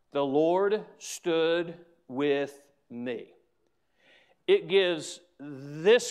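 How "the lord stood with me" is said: slow and low, each word set apart; grave, drawn-out declaration; solemn scripture quote. Acted. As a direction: let each word land, low and unhurried. Solemn climax of quoted verse.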